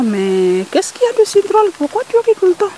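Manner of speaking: voix de poulpe bien plus haute et agacée; le poulpe s'impatiente, voix haute; poulpe intrigué. Acted. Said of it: "le poulpe lui demande encore". (le poulpe demande au rat pourquoi il rit, voix haute)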